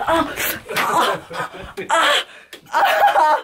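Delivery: high, pained laugh-cry; real hurt, laughing through it. Real, not acted. Hurt shin, laughing in pain.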